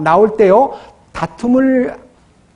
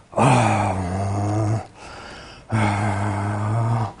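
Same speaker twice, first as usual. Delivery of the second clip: deep calming sighs; 10 semitones lower, 10 dB quieter, much slower than usual. Retold or imitated. 성질 가라앉히는 사람 흉내.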